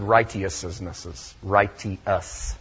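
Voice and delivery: righteousnesses and Righteous said as "RIGHT-ee-us", three syllables; Old English pronunciation. Shown as a demonstration. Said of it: "Old English would have said".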